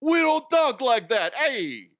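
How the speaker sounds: loud indignant New York caricature; a New Yorker shouting back in a hammed-up accent; mock New Yorker. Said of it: "I bet they hate that".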